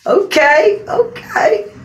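high, loud delighted cry; excited squeal. Retold or imitated. Girlfriend seeing the new TV, squealing high and loud. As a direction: squeal with joy at the gift. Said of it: "I'm like".

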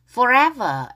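"forever" with distinct f and v; F and V sounds. Shown as a demonstration. Teacher drilling learners, f and v marked in "forever". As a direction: say "forever" minding f and v, firmly. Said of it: "V 발음 주의하세요".

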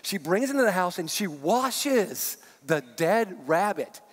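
ordinary talk

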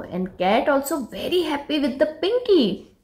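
ordinary delivery, nothing marked

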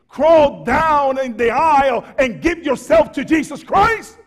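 loud, rising to a high shout; preaching shout. Real, not acted. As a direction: cry out the altar call. Altar call climax.

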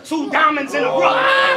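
high, loud, slow shouted bar; shouted punchline. Acted. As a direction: drag it out and yell it.

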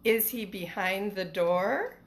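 usual speaking voice

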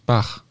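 Bach with throaty ch, bax; Welsh Bach. Shown as a demonstration. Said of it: "im Walisischen Bach".

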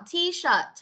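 high, clear "T-shirt" with puffed ending; final T released. Shown as a demonstration. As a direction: let the last T out in "T-shirt". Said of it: "Âm thờ đâu con?".

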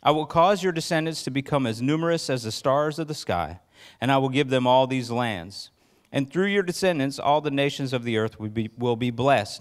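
measured, solemn reading of the promise; scripture reading. Acted. Pastor reading Genesis to the church, solemn and measured. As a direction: read God's words to the congregation with gravity.

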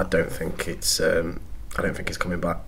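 quiet, hushed and downcast, near a whisper; heartbroken. Real, not acted. To a co-host teasing him, quietly heartbroken. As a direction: let the words sink, resigned to losing a favourite player. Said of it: "You're bloody heartbroken".

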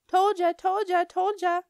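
high, loud singsong jeer; gloating taunt. A narrator's reading. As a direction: chant it to rub it in. Mean Jim gloats at her.

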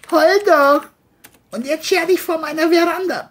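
high, loud sneer; John mocking. John jeers at the crying boy, high and loud. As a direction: jeer at the crying kid.

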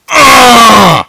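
loud pained grunt. Real, not acted.

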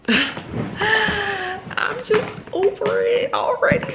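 high, slow laughing groan; laughing at her own frustration, drawn out and high; exasperated laugh. Real, not acted. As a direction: laugh it out, completely fed up.

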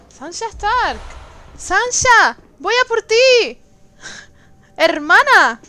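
high, loud calling across distance; loud searching call. Real, not acted. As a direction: call to your sister far away. Calling out to lost sister.

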